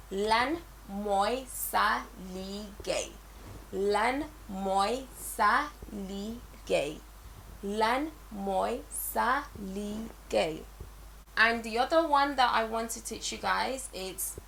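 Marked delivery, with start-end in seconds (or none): 0.1-10.6 "Lan moi sa ligay" stretched out, slow and clear; slow drill. Shown as a demonstration. Teacher drilling learners slowly on Lan moi sa ligay. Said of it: "teacher drilling phrase".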